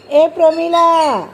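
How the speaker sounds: loud, drawn-out name call. Real, not acted. Calling the child by name.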